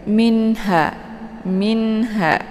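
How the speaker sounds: minha with a crisp clear n and no hum; recited minha with a distinct n. Shown as a demonstration. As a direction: say the n of minha cleanly, then ha. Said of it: "nunnya dibaca jelas".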